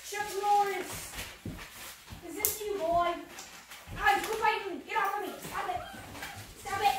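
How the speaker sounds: high playful scolding; high pet voice. Real, not acted. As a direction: fend off a nipping puppy. Puppy biting her.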